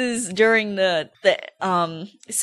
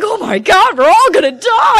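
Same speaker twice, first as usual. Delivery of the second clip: high, loud mock wail of terror; loud, shrieky pretend freak-out; cowering panic; 12 semitones higher, 9 dB louder than usual. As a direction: cry out in hammed-up panic. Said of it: "He's sitting there cowering like".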